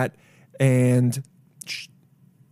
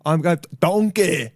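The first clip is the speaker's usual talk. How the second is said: drawn-out, put-on ogre voice; exaggerated Shrek impression; 4 semitones higher, slower than usual. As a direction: slowly call out like a cartoon ogre. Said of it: "I'm like Shrek".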